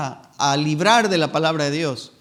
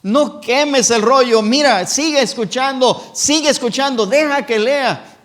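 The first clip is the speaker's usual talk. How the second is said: louder, higher, slow urgent begging; desperate pleading; 7 semitones higher, 8 dB louder, slower than usual. Retold or imitated. Followers pleading louder with the king to keep listening. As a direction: beg harder, voice rising. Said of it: "Dice la Biblia que le rogaron".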